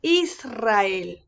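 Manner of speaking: "Israel" with a trilled R after the S; strong rolled R. Shown as a demonstration. Teacher showing the strong sound after S, rolled R. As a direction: roll the R firmly in "Israel". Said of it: "lo leemos con un sonido fuerte".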